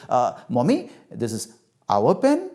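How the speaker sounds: unsure answer, rising like a question; testing the answer with a final lift; tentative child. Acted. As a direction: offer it hesitantly, checking for mother's approval. Child tests a new answer.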